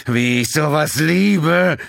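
slow, strained and forced out through gritted teeth. A narrator's reading. The pilot fighting the controls, squeezed out through gritted teeth. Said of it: "presste er zwischen zusammengebissenen Zähnen hindurch".

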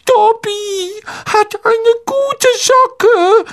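very high, slow elf voice; squeaky disbelief, drawn out; ungläubiger Hauself. A narrator's reading. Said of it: "sagte Dobby ungläubig". (sehr hohe, ungläubig gedehnte Elfenstimme)

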